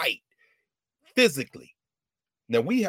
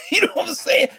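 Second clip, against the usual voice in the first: laughing shout; 6 dB louder than usual. Real, not acted. Laughing delight after quote.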